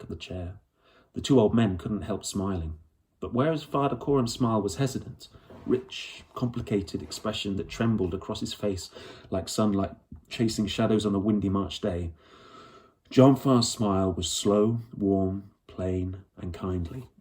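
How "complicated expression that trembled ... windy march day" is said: ordinary delivery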